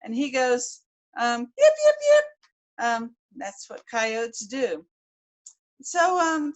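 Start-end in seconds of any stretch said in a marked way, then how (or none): 1.4-2.8 high loud yapping coyote yips. Shown as a demonstration. Storyteller showing listeners how coyotes yip.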